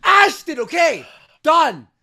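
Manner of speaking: high, loud, final yell; real furious shout; angry shout. Real, not acted. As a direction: shout him down for good. Friend yelling to end the bit.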